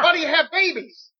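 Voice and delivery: high, mock-bewildered outburst; clueless old-timer. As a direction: play a man utterly baffled. Continues clueless Backlund bit.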